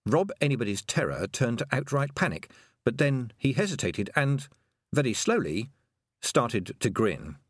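plain reading voice

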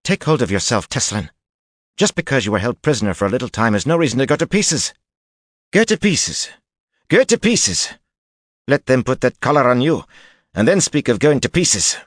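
high, loud, scornful Aes Sedai. A narrator's reading. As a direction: spit the words with contempt. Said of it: "Jolene spat".

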